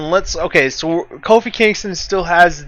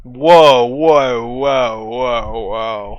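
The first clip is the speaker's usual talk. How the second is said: fading whoa; 4 semitones lower, much slower than usual. Real, not acted. Surprised whoa fading away.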